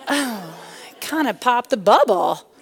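ordinary delivery

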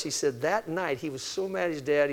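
ordinary delivery

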